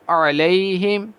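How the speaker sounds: slow, drawn-out melodic tajweed chant; Quran recitation. Shown as a demonstration. Teacher reciting Quran for students. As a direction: recite each verse long and measured.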